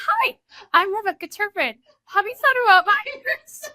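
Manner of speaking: high, bright mock sales greeting; playful high-pitched impression of a friend introducing herself; bubbly pitch. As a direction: imitate her chirpy cold approach, high up.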